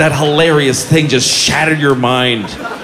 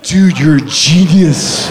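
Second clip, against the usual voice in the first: mock bro praise; slower than usual. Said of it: "Now, it's like".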